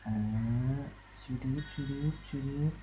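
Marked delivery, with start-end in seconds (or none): 1.2-2.7 car sound effect. Pretend.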